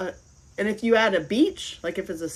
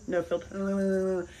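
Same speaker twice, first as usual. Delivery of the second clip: mock aside; much slower than usual. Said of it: "Angela has no filter".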